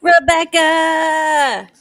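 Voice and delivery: loud, high, long-held shout; drawn-out name call. Pretend. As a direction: holler the name and stretch it right out. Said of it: "I have to say that".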